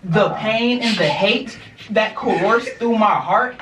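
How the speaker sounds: ordinary talk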